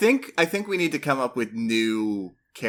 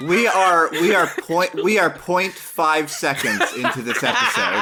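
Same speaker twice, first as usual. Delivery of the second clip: laughing exasperation; 5 semitones higher, 4 dB louder than usual. Real, not acted.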